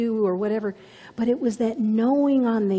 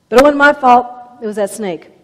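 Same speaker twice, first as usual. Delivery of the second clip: high, loud protesting; defensive outburst; 4 semitones higher, 13 dB louder, faster than usual. Acted. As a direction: blurt out the excuse loudly. Eve defending herself to God.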